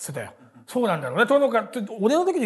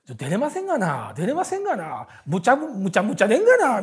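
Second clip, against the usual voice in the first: imitating Kishibe's whiny Osaka-dialect grumbling, building louder; a light Osaka-dialect grumble; mock Osaka complaint; 3 semitones higher, 5 dB louder than usual. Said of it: "みたいな大阪弁でずーっと文句言ってたわ".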